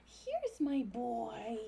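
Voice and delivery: drawn-out doting coo; talking to a dog. Real, not acted. Slow cooing to dog.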